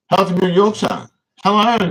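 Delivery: high, loud cheery hello; excited greeting. Real, not acted. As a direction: call out the hello brightly. Greeting a viewer on livestream.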